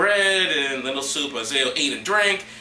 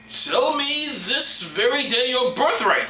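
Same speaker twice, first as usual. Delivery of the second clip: high, slow, drawn-out demand; Jacob character voice; 10 semitones higher, 3 dB louder, slower than usual. Acted. Jacob bargaining with his starving brother, slow theatrical demand. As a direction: stretch each word in a theatrical put-on voice. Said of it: "that's too my Jacob voice".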